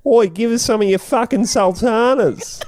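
mock Aussie bogan. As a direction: yell it like a cartoon Aussie bloke at the barbecue. Mock Aussie bogan demanding sultanas.

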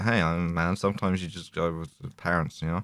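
bored, low offhand murmur; Barack Obama. Retold or imitated. Imitating the president answering a staffer, bored low murmur. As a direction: trail off quietly, barely interested. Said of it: "a clearly bored Barack Obama goes".

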